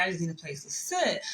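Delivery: usual speaking voice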